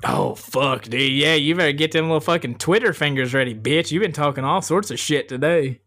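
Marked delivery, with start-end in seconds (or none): none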